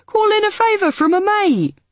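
brash advice in a high voice; pier guy voice. A narrator's reading. Pier guy's advice quoted.

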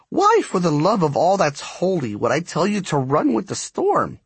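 high, indignant character voice; exasperated spark striker. A narrator's reading. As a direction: demand an answer in high-pitched exasperation.

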